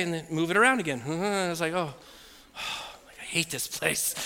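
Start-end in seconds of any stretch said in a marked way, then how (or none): 1.7-4.1 slow, quiet sighing complaint; weary misery. Retold or imitated. Patient stuck with beeping machine, weary despair. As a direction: sigh it out, drained.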